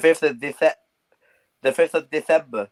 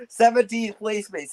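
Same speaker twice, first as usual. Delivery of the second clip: slow, high mocking jeer; slow gloating jab at a rival's league place; drawn-out taunt; 8 semitones higher, slower than usual. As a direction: stretch it out to rub it in.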